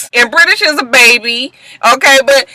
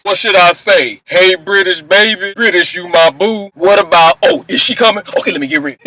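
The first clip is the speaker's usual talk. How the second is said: lower, fidgety nervous voice; nervous Lorenzo; 5 semitones lower than usual. Retold or imitated. Nervous boyfriend asking producers where to stand, lower and fidgety. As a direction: fret like a man waiting to propose. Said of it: "I could just hear him talking to the producers".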